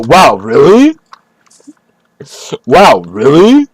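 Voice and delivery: mocking wow with really swooping up high; mock astonishment, rising sharply at the end; kpiące naśladowanie. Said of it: "Just start making fun of him".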